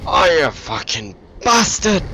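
slow, high, loud cursing yell; furious swearing. Real, not acted. British gamer cursing the enemy who killed him, furious swearing. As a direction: drag out the curse in full rage. It probably sounds weird swearing.

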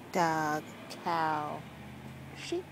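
usual speaking voice

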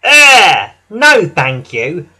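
loud wheezed refusal; James. A narrator's reading. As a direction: blurt a high "eh", then refuse loudly. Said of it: "wheezed James".

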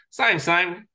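mock the MD's careless answer; dismissive boss.

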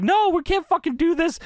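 high-pitched retold objection; mock alarmed protest. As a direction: imitate a boss frantically objecting. Voicing alarmed commentator's protest.

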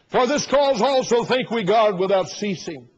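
slow, loud King James reading; scripture reading. Acted. Preacher reading First Thessalonians to the church. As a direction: read it out with weight.

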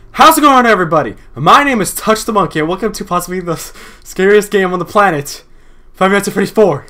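big YouTuber welcome; hyped intro. Acted. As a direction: greet the viewers like an announcer.

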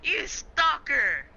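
drawn-out playful accusation; teasing accusation. Real, not acted. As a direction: stretch it out, teasing. Mock accusation.

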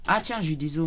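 ordinary delivery

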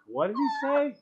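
high-pitched confused question; baffled. Acted. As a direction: play a puzzled listener. Acting out baffled Polish listeners.

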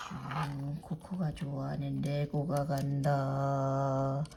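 low, slow, drawn-out toy-flying voice; low droning whoosh; playful swooping narration. Pretend. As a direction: stretch the words like a toy gliding past.

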